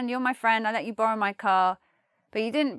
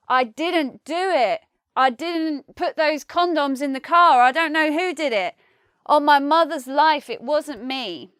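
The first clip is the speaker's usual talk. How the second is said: pleading sincerity; 3 semitones higher, 4 dB louder than usual. Acted. Friend swearing innocence about the car, pleading. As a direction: beg to be believed. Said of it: "if I really want you to believe me".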